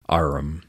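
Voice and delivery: plain reading voice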